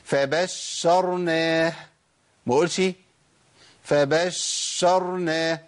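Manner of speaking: فبشرناه with the ش dragged out much too long, very slow; overlong shin, wrong. Shown as a demonstration. Teacher showing students the too-long shin. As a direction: stretch the shin in فبشرناه as an error. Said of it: "ده كتير قوي كده".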